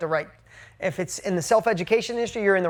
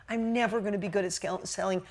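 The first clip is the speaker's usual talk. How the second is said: ordinary talk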